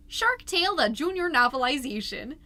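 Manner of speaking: fast deadpan run of titles; rattled-off list. Acted. Mom listing junk titles in the box, fast and building.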